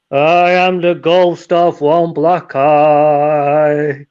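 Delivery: slow, drawn-out, eerie ghost moan. Acted. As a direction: stretch every word like a spooky ghost. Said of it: "And he hears this voice".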